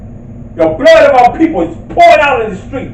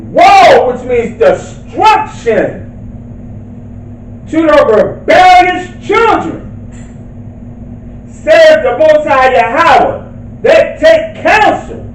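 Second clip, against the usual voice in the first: slow, emphatic proclamation of the verse; forceful scripture reading; 6 semitones higher, slower than usual. Real, not acted. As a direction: proclaim the scripture slowly, pitch raised on key phrases.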